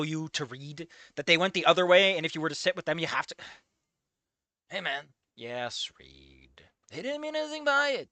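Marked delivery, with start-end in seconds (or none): none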